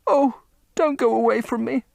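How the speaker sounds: high, tearful begging; crying plea. Acted. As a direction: sob out the plea.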